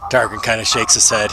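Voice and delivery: ordinary delivery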